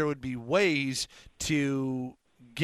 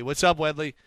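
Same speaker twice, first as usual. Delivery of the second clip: ordinary talk, nothing marked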